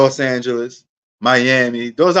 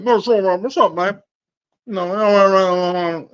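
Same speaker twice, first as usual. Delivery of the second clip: raised pitch, mumbly lazy Texas drawl; Boomhauer impression; 5 semitones higher, slower than usual. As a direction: toss off a slurred Boomhauer greeting, twice. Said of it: "like, Boomhauer walk".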